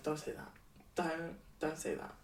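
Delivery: whisper-close, wounded; pleading softly. Retold or imitated. Telling a friend what she begged the strangers, soft and hurt. Quoting her distressed drunk self.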